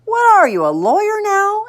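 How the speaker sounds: high-pitched sarcastic question; exasperated, high mom voice; incredulous mother. A narrator's reading. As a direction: needle the kid, voice shooting up. Mother mocks son's bargaining.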